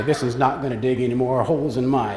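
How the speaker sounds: low, drawling cowboy voice; John Wayne voice. Retold or imitated. Husband posing with the armadillo, low cowboy voice. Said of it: "in my best John Wayne voice".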